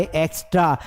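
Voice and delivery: एक्स्ट्रा as "eks-traa"; correct extra. Shown as a demonstration. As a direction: model एक्स्ट्रा with joined "str". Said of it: "ये एक्स्ट्रा है". (एक्स्ट्रा में "स्ट्र" एक साथ)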